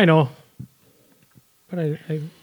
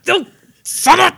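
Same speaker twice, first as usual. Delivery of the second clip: exasperated outburst; 12 semitones higher than usual. Acted. Exasperated rant at fans.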